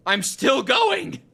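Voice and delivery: high, loud, drawn-out outcry; exclaiming in disbelief. Real, not acted. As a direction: stretch it out in loud astonishment. Still sinking, exclaims in disbelief.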